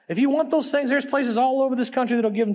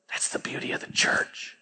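soft breathy whisper; 7 dB quieter than usual. Real, not acted. Pastor telling the room about the church, in a whisper. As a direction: drop to a hushed whisper for emphasis. Quiet climax of sermon.

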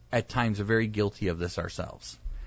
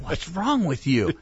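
scolding wife; 6 semitones higher, slower than usual. Retold or imitated. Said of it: "My wife's like".

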